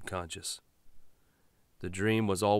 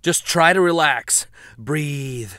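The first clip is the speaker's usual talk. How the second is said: raised, strained husband's voice; tense husband; 7 semitones higher, 11 dB louder, slower than usual. Acted. Driver answering his wife in the back, strained. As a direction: hold it together while driving.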